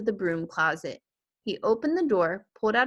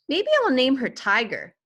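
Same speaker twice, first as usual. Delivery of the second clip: put-on child voice; 5 semitones higher, 5 dB louder than usual. Child character voiced in story.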